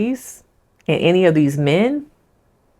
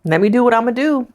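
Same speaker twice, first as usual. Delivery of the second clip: mock Tyler; 6 semitones higher than usual. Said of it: "It was like".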